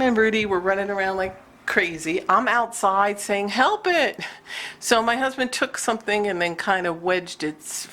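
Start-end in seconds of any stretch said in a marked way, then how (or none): 3.5-4.2 higher, mildly urgent mock cry; retold plea. Screaming for husband to free a mouse, mild mock cry. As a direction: call out a half-hearted plea for help. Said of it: "I was screaming for my husband".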